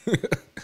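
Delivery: short high amused laugh; a quick high burst of laughter. Real, not acted. Commentator laughing at the vice president, high burst. Mocking laugh after imitation.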